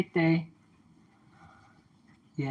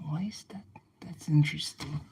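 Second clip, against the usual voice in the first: whisper; 12 dB quieter than usual. Real, not acted. Whispering to himself.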